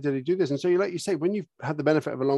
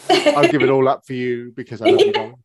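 higher, louder doting voice; gushing horse voice; 6 semitones higher, 6 dB louder than usual. Retold or imitated. Dreamed-of horse gushing to its owner, hammed-up doting voice. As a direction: gush like a lovesick horse.

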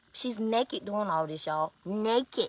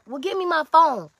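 high, loud comeback; retold angry demand; 7 semitones higher, 6 dB louder than usual. Answering the woman who holds the phone, angry demand. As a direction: demand it back with a sudden jump in pitch and volume. Snaps back in retold argument.

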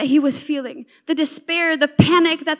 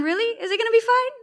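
higher, rising incredulous question; sceptical challenge; 6 semitones higher, much faster than usual. Acted. Sufferer answers empty comfort, sceptical rising challenge. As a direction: question it doubtfully, pitch climbing at the end.

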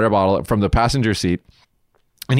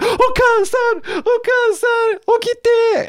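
mock wail, much higher and slowly drawn out; hammed-up wailing daughter; much slower than usual. Daughter begging her comatose mother to wake up, hammed-up wail. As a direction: overplay a melodrama daughter crying for her mother.

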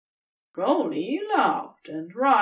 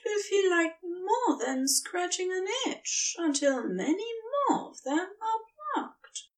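much higher, crying through tender words; tearful reassurance; 11 semitones higher than usual. A narrator's reading. Tree reassuring the serpent, tearful and tender. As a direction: comfort him while tears break through.

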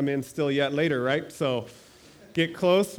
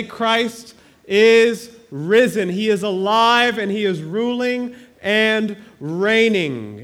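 slow, emphatic preaching, a touch louder; preaching proclamation; 2 semitones higher, 5 dB louder, slower than usual. Acted. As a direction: proclaim it to the congregation.

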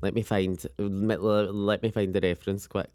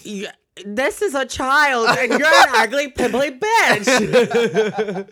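much higher, louder, slow laughing squeal; 12 semitones higher, 10 dB louder, slower than usual. Retold or imitated. Mocking the young date to a friend, laughing squeal.

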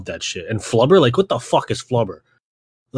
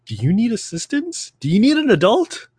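mock concern; 7 semitones higher than usual. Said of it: "I'm like".

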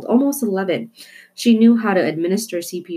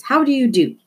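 higher, louder greeting to cover up; 8 semitones higher, 4 dB louder than usual. Acted. Father loudly greeting the waitress to cover the squirrel, louder and higher. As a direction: call it out brightly to distract. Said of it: "in a much louder voice".

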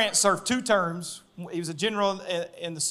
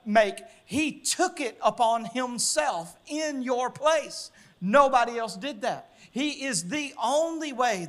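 preaching at full force; 5 semitones higher than usual. Acted. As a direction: drive it home to the congregation. Preacher builds to altar call.

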